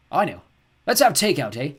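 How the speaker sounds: loud, bright cheery dad. A narrator's reading. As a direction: pitch it as a fun idea.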